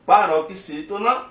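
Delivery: ordinary delivery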